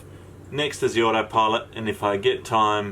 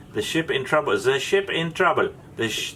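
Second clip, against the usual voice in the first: higher-pitched mock German accent; 4 semitones higher than usual. German radio officer replying to a mayday in a joke, mock German accent. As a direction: put on a German coast guard officer's accent. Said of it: "that's my German accent".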